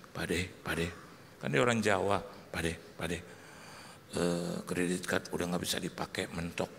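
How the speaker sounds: low, soft approach; imitated bad-news bearer. Ponakan membawa kabar buruk.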